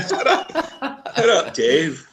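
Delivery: amused, high and laughing. Real, not acted. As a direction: say it while cracking up. Laughing at the running joke.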